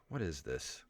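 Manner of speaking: usual speaking voice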